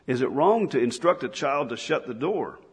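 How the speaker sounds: ordinary delivery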